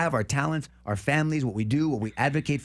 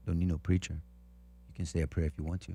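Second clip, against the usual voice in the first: lightly voiced condemned inmate; 6 semitones lower, 7 dB quieter than usual. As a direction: softly voice the gentle giant. Inmate's voice in retold scene.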